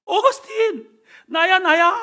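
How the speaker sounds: high, loud calling out by name; excited greeting. Acted. Old lover hailing Augustine on the street, excited. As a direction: call out gleefully across the street. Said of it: "반갑게 인사를 합니다".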